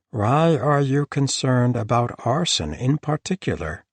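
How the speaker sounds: each word carefully placed; careful diction. A narrator's reading. The cat questioning the host, in careful precise diction. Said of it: "in his most careful diction".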